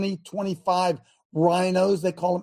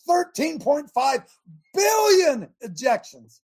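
loud, slow, emphatic shout; 6 semitones higher, 3 dB louder than usual. Real, not acted. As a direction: belt out the number. Preacher shouts out the figure.

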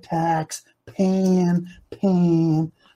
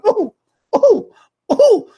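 high ooh cries; 13 semitones higher, 6 dB louder, slower than usual. Acted. Preacher mock-shivers at faith.

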